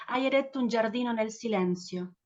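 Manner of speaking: ordinary delivery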